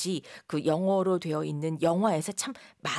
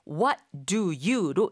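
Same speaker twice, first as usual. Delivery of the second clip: slow model phrase; much slower than usual. Shown as a demonstration. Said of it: "각각 맞는 동사를 이어 말하면".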